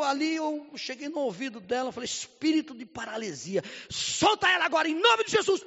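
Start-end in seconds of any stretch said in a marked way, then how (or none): 2.1-5.6 rising to a much higher, louder command; commanding rebuke. Retold or imitated. Pastor commands the paralysis spirit to release the girl, loud rebuke. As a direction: order the spirit out.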